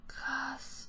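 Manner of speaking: awed whisper. Real, not acted. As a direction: breathe it in awe. Amazed at score. (ehrfürchtig geflüstert)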